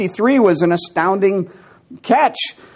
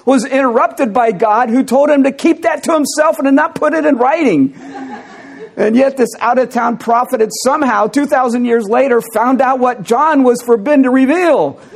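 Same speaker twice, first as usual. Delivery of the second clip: high, incredulous telling on the edge of laughter; amused, near laughter; 6 semitones higher than usual. Real, not acted. Preacher telling congregation about an out-of-town prophet, amused and near laughter. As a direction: let the absurdity lift your voice into a laugh. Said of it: "can't really get through without laughing".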